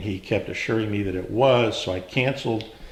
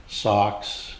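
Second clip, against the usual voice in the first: normal talking voice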